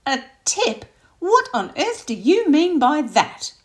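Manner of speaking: high, loud and slow indignant question; outraged mother. Acted. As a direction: demand an explanation, scandalised. Mum outraged about tipping.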